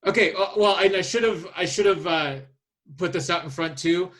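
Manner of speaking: ordinary talk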